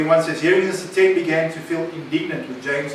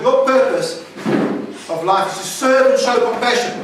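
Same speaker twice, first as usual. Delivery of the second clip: "Your" pressed up and louder, aimed at them; personal, pointed stress on "Your"; make it personal; 5 semitones higher, 4 dB louder than usual. Shown as a demonstration. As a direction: say "Your purpose" straight at the listener. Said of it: "you make it personal".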